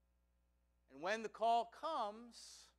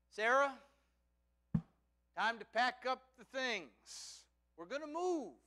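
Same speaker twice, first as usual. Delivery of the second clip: raised, higher announcing voice; calling out; 5 semitones higher, 7 dB louder than usual. Acted. Abraham comes home to Sarah, calling out the news. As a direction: call to your wife across the house. Abraham calls out to his wife.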